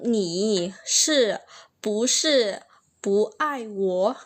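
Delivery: slow, "Ni shi" then "bu shi" then "bu ai wo" with pauses between; slowly, word by word. Shown as a demonstration. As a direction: break "Ni shi bu shi bu ai wo" into slow chunks so each word is clear. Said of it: "perlahan-lahan dia bilang".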